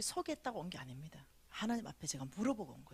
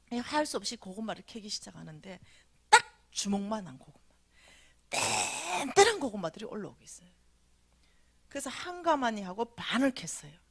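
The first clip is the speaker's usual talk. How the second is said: ordinary talk, nothing marked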